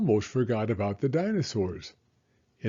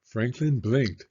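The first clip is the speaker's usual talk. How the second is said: plain reading voice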